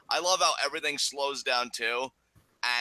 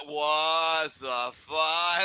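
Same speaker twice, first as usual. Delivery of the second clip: very slow, drawn-out mock shock; imitating stunned disbelief; 5 semitones higher, much slower than usual. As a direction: stretch every word in raised-pitch disbelief. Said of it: "his face was like".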